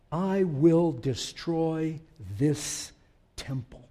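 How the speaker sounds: drawn out slowly and quietly, like reading off the page. Shown as a demonstration. Preacher reading the verse to the congregation, slow and quiet. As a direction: let each word hang, softly. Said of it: "look at the text".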